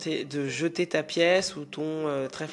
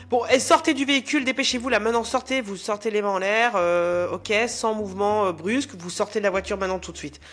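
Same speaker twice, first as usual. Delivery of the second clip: high, loud, rushed police commands; panicked orders; 4 semitones higher, 4 dB louder than usual. Acted. Policeman ordering the armed driver out of the car, loud and urgent. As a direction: bark orders at the driver, fast.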